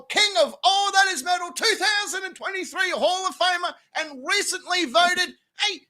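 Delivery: high, loud ring-announcer build-up; booming host introduction rising to a shout. Acted. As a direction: hype the crowd, build to the name. Announces guest like a show host.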